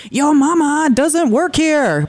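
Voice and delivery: mock drill sergeant bark, high and slow; hammed-up drill instructor yell. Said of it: "some drill sergeant yelling".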